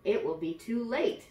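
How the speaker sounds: ordinary delivery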